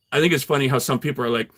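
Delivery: normal talking voice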